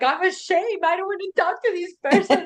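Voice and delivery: high, whiny inner voice; embarrassed. Retold or imitated. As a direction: squeak it out, mortified. Voicing inner embarrassed thought.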